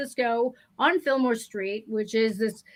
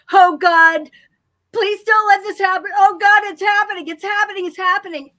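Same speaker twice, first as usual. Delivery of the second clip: much louder, higher desperate pleading; panicked plea; 6 semitones higher, 9 dB louder than usual. Retold or imitated. Mother in the toy aisle dreading her baby's accident, loud panicked pleading. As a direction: cry out in dread as it happens. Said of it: "this woman in the next aisle going".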